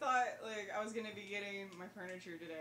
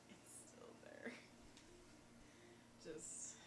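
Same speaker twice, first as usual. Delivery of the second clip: hushed, voiceless echo; barely-there whispered aside; 4 semitones lower, 17 dB quieter, slower than usual. Real, not acted. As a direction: repeat it in a real whisper. Repeats it in a whisper.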